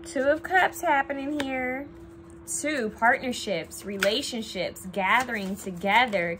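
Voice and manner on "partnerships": usual speaking voice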